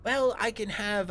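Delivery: high, loud villain voice; drow master speaking. Acted. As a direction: lift the pitch way up for the drow. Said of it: "um, he goes".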